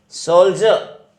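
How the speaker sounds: सोल्जर said high, ending "soldjuh" without the र; सोल्जर with the र left silent; silent final r. Shown as a demonstration. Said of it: "र इसके अंदर फिर हमने silent रखना है".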